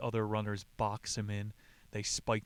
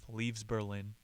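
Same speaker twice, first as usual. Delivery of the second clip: ordinary delivery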